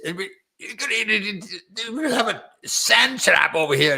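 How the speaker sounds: high, hammed-up Scottish brogue; Scottish accent. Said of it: "the Scottish accent".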